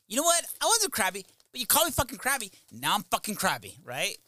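high, loud mock outburst; retold angry rant. Husband snapping at his wife for calling him crabby, high and loud. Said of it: "I'd be like".

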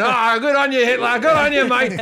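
hammed-up matey praise; hearty approval. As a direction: cheer him on like a mate. Acted crowd cheering the boss on.

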